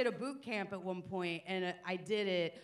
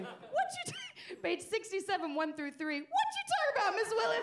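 put-on much higher, squeaky kid catchphrase, laughing through it; high squeaky Arnold impression; 11 semitones higher than usual. Student blurting Arnold's catchphrase at the teacher, in a high squeaky voice. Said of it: "Just like Arnold".

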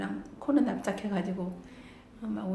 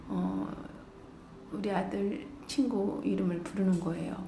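ordinary delivery

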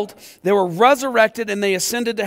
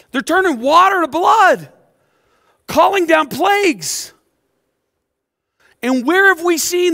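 fiery preaching; 5 semitones higher than usual. Acted. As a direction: preach it out with fire, building to a peak. Preaching at full force.

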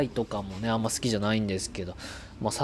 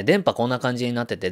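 ordinary talk, nothing marked

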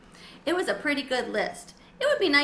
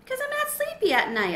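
much higher squeaky answer; squeaky hamster; 3 dB louder than usual. A narrator's reading. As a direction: hamster explaining to guinea pig.

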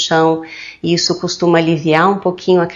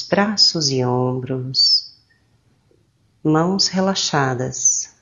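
slow, soothing meditation voice with drawn-out phrase endings; calm, slow guidance with long pauses; guided meditation; 3 dB quieter than usual. Acted. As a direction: guide them gently into relaxation, unhurried.

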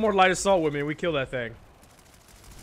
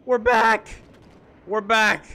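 excited; 6 semitones higher, 5 dB louder, slower than usual. Real, not acted. Game comes back after crash.

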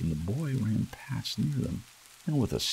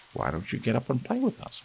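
put-on higher, quick teasing young rabbit; wild rabbit asking; 6 semitones higher, faster than usual. A narrator's reading. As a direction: toss the invitation up quickly, playful. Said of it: "one of them asked".

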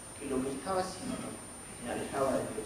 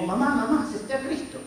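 excited; 8 semitones higher than usual. Retold or imitated. Ten-year-old knocking on mother's door, excitedly calling. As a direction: burst in with the big news. Boy waking mother with news.

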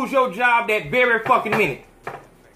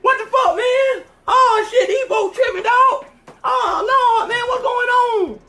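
much higher, shouted mock panic with laughs; hammed-up panicked shout as the second man sees the point man hit; mock panicked soldier; 6 dB louder than usual. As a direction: play a rattled soldier freaking out under fire.

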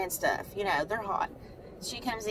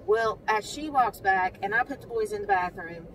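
ordinary delivery

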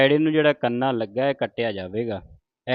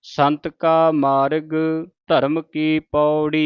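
ਸੰਤ ਕਾ ਮਾਰਗ, short pause, then ਧਰਮ ਕੀ ਪੌੜੀ with a full stop; slow, with a half pause after ਮਾਰਗ and a full stop on ਪੌੜੀ; ਮਾਰਗ ਤੇ ਛੋਟਾ ਵਿਸਰਾਮ, ਪੌੜੀ ਤੇ ਪੂਰਾ ਵਿਸਰਾਮ; pause at the right place; much slower than usual. Shown as a demonstration. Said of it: "ਇਸ ਤਰ੍ਹਾਂ".

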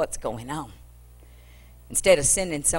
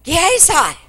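calling out; 11 semitones higher, 7 dB louder than usual. Acted. Prophet calls his servant.

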